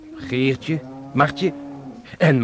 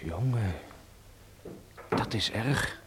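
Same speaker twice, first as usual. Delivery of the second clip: hushed dismay; 3 semitones lower, 12 dB quieter, slower than usual. Acted. Son reacts to pregnancy news.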